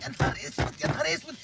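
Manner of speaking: dismayed whisper. Acted.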